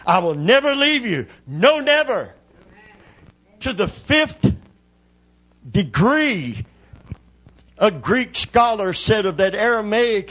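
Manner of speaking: high, loud revival preaching, building in intensity; full-force preaching. Real, not acted. Preacher rousing the congregation about God's promise, full-force preaching. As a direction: preach it at the top of your range.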